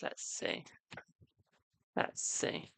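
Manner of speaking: breathy whisper while reading the cards; whispered to herself. Real, not acted. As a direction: whisper it under your breath, consulting. Psychic consulting the cards.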